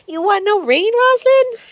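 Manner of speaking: very high, squeaky teasing question; high pet voice. Acted. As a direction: tease the goat in a squeaky voice.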